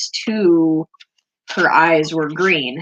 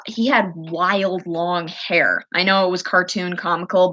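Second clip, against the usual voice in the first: ordinary talk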